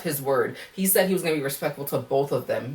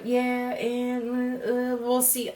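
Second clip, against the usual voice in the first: a light imitation of a noncommittal guy, a bit higher and softer; lackadaisical; 7 semitones higher, 5 dB quieter, much slower than usual. Mocking Kenny's vague answer to Janae, softly noncommittal. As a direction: shrug it off, mildly mocking his vagueness. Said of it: "He's just a little too lackadaisical".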